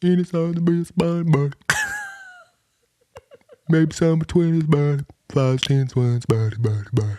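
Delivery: put-on high baby talk, gradually descending; puppy-greeting voice. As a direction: answer as if cooing to a puppy. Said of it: "trying to do it to just people".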